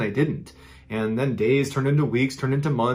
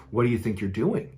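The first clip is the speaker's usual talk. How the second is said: lower, softer voice of a strange man; confronting stranger; 3 semitones lower, 5 dB quieter than usual. Retold or imitated. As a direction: lightly imitate a stranger's low, quiet challenge. Creepy stranger confronts child in story.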